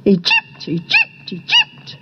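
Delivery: squeaky high bird chirps on the word; honeyeater's cry. A narrator's reading. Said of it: "crying out about the trip".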